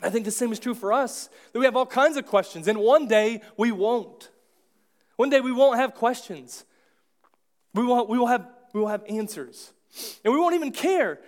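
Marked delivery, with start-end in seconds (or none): 1.5-11.2 building excitement. Real, not acted. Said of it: "preaching climax".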